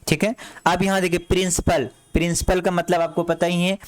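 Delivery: usual speaking voice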